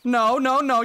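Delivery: sharp scolding no; loud and firm, stop them. Real, not acted. Scolding someone interrupting video.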